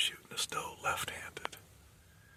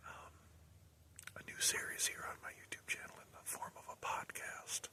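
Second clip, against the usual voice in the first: hushed vlog outro whisper; 8 dB quieter than usual. Real, not acted. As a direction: whisper the sign-off quietly.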